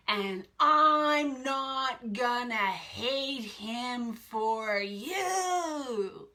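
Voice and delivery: slow, drawn-out mock outrage; understated imitation of an angry outburst; retold rage. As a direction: re-enact his fury at half force, stretching each word. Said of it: "And his response was".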